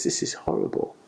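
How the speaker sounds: breathy, appalled whisper with rising pitch; hushed, aghast whisper; horrified whisper. Retold or imitated. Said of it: "he just says".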